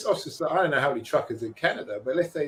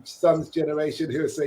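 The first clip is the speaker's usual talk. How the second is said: usual speaking voice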